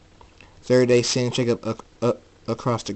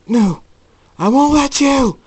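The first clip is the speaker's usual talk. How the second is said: loud, high-pitched defiance; defiant shout; 10 dB louder than usual. Acted. Night Storm as his eye turns red, defiant shout. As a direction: shout them down with full force.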